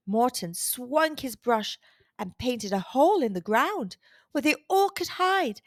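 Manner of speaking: usual speaking voice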